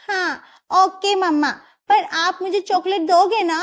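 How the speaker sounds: put-on sweet coaxing child voice; little girl asking. Girl asks her mother for chocolate, lightly childlike.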